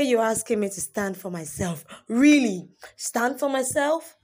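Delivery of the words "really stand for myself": incredulous. Acted. Incredulous retort.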